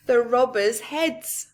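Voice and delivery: ordinary delivery, nothing marked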